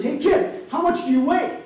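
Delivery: a loud, high retold call across the track; high hailing call, half acted; mock shout. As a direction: play the coach barking out at the kid. Coach jumps out calling to kid.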